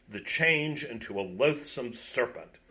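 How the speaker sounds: ordinary delivery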